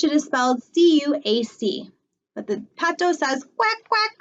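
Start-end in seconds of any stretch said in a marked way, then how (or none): none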